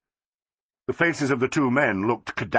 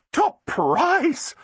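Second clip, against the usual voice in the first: high, shrill delighted cry. A narrator's reading. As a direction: squeal it out in delight. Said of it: "cried Flossie shrilly".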